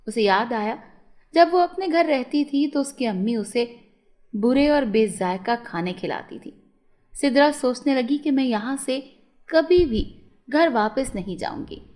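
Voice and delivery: ordinary delivery, nothing marked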